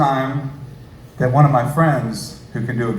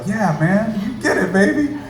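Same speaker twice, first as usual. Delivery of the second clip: excited; 8 semitones higher than usual. Retold or imitated. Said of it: "And I was like".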